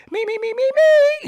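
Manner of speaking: high, loud mock singer's warm-up; vocal warm-up. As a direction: squeal out exaggerated "me-me-me" voice exercises. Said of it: "in the crib and be like".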